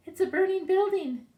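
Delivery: high, loud dramatic reveal; excited reveal. Real, not acted.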